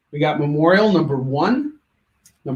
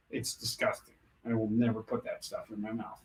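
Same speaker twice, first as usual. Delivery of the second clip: soft, low disgusted aside; 5 semitones lower, 13 dB quieter than usual. Real, not acted. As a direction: turn away in disgust, quietly. Disgust at cow tongue.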